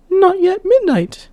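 put-on a young maiden, high and light, asking; high girlish maiden voice. Maiden asking the old woman, high girlish voice. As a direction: lift into a bright girl's voice.

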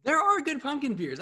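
high, insistent comeback; emphatic. Real, not acted. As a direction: fire back the answer. Defiant answer to challenge.

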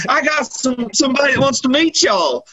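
high-pitched mock excitement; excited announcement. Movie character wants the family to meet somebody, imitated excitement. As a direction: imitate someone bursting with an announcement. Acts out man announcing a guest.

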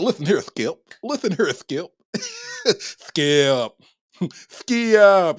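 lightly voiced mock Shannon Sharpe, calling Skip; Shannon Sharpe impression. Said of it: "she got them Shannon Sharpe features".

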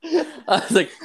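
big squealing burst of laughter; cracking up at the punchline; high shrieking laugh. Real, not acted. Retelling a crude story, shrieking with laughter.

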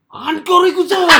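drawn-out, high, very loud imitated yell; mock shout. Co-host teasing about the friend's sudden outburst, mock shout. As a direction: belt it out slowly as a joke shout. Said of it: "Temen lu tiba-tiba teriak".

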